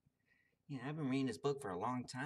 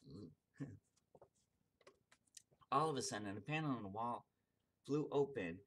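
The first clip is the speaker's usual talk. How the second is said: ordinary delivery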